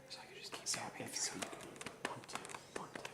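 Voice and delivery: fast hushed whisper. Real, not acted. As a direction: whisper it so others miss it. Whispering a secret signal.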